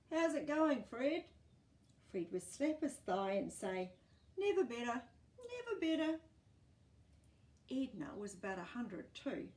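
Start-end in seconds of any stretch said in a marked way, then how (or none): 0.1-1.2 higher, louder friendly call across the garden. Acted. Neighbours calling out over hedge. 4.4-6.2 lightly voiced cheery old man, higher; a hint of a jolly old neighbour slapping his thigh; old Fred, cheery.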